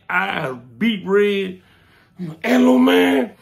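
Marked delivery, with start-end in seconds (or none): 2.4-3.3 huffing reply. Retold or imitated. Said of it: "He was huffing and puffing".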